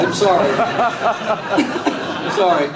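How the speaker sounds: slow, laughing sorry; laughing apology. Real, not acted. Laughs at own joke, apologising.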